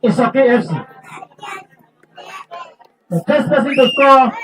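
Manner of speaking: radio sports presenter. Real, not acted. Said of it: "that radio voice".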